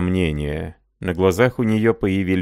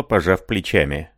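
ordinary delivery, nothing marked

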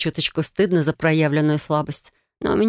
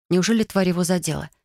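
plain reading voice

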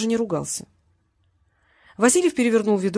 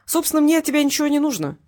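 cheerful; 7 semitones higher, 4 dB louder than usual. A narrator's reading. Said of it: "жизнерадостно провозгласил Васильев".

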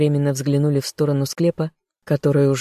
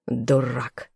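spat insult; 3 semitones lower, 3 dB quieter than usual. A narrator's reading. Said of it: "сплюнул Игнатич".

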